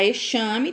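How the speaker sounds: "exame" mispronounced e-SHA-me; "exame" with sh in place of z; wrong X sound. Shown as a demonstration. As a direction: show the mistake, "exame" with sh. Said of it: "tá errado".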